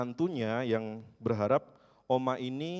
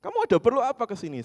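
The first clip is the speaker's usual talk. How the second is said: high, loud and fast grumpy grandma; imitating a cranky grandma shouting; snapping old woman; 11 semitones higher, 7 dB louder, faster than usual. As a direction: bark at the visitor to go away. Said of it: "Ditengking sama oma ini".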